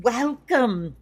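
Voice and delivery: put-on a loud, high 'welcome' in a hammy Scottish accent; bad Scottish accent. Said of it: "you're gonna get to hear some bad Scottish accents".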